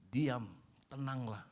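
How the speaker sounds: slow and gentle; slow, calm verse. Shown as a demonstration. Pastor rereading the verse to the congregation, slow and calm. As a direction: let the calm settle in. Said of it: "verse repeated slowly, soft".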